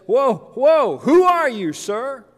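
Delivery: high, loud, startled questioning; acted trembling alarm. Saul knocked down by Jesus, acted startled fear. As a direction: recoil in mock alarm, then ask. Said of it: "must have been trembling by this time".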